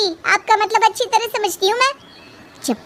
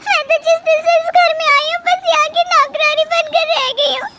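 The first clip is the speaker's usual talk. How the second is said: high, crying complaint to her bhabhi; tearful complaint; 9 semitones higher than usual. Acted. Bride tells sister-in-law her husband scolded her, crying. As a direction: sob out your grievance.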